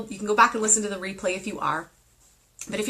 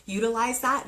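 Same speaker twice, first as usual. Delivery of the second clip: ordinary delivery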